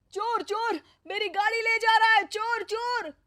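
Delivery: high, frantic cries of thief; yelling in alarm as the new bike is taken; panicked shout. A narrator's reading. Husband spots someone taking his bike, panicked high yell. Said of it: "वो घबराकर चिल्लाता है".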